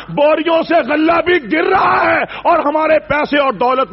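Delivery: high excited call over the grain sacks; excited cry. Acted. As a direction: shout the surprise out.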